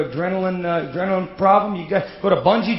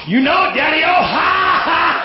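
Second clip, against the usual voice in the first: put-on loud high drunk cackle; silly clown; 10 semitones higher, 5 dB louder, slower than usual. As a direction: shout it like a giddy drunk. Drunk driver answering cop.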